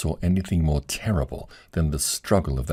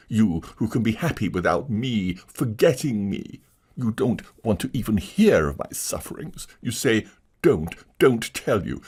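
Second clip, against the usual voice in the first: bitter tragedian; 5 semitones higher than usual. Acted. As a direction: hammer her with grievance.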